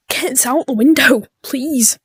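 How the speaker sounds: ordinary talk, nothing marked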